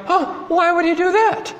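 high-pitched mock dismay; hammed-up scandalized question; mock shock. Mimics people shocked at God's wrath.

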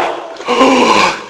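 acted, high gasp of shock; sharp mock intake of breath. Acts out hearers' shock.

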